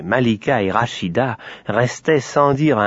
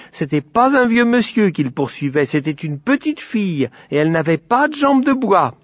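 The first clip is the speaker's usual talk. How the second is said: put-on high, emphatic little-girl objection; energetic; 9 semitones higher than usual. A narrator's reading. Rachida corrects the sun's story, energetic protest. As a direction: contradict with childish vigour. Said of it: "dit Rachida avec énergie".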